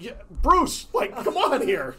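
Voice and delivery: laughing, high-pitched teasing of the coach; laughing mock plea. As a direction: tease the coach while cracking up. Teammates mocking, laughing.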